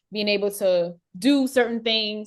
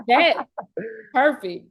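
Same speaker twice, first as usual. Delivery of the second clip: ordinary talk, nothing marked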